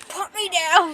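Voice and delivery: high, loud mock yell; indignant protest. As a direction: cry out as you're hoisted up. Picked up, she protests.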